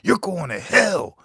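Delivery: high, loud, slow preachy condemnation; imitating a fired-up church member; accusing churchgoer. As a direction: wag a finger and damn him. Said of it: "he said".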